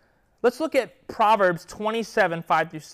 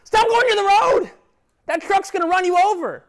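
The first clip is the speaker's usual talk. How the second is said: put-on much higher and louder, yelling at the kids; shouted warning; 12 semitones higher, 10 dB louder than usual. A father yelling at his kids near the road, shouting. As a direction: yell it to stop them near the road. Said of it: "I would say".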